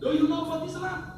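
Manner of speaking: pertanyaan tinggi dan keras; tanya lantang dengan nada tinggi; bertanya lantang. Retold or imitated. Bertanya pada calon mualaf. (menanyai bule itu dengan lantang)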